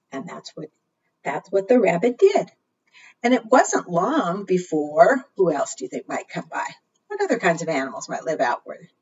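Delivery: ordinary talk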